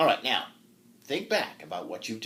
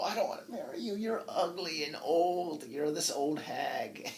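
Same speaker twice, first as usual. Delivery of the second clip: whiny knight; 6 semitones higher, 3 dB quieter than usual. Acted. Knight refusing old woman.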